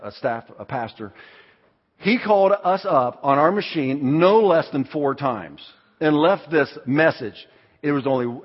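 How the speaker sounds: normal talking voice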